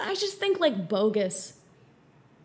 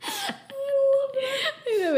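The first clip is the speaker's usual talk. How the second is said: high squeal, words swallowed by laughing; delighted high laughing voice; helpless laughter; slower than usual. Real, not acted. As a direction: say it while cracking up. Cracking up at the mock commentary.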